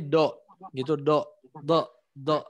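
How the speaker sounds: light, unraised do sound; wrong thin dod. Shown as a demonstration. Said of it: "nggak tebal".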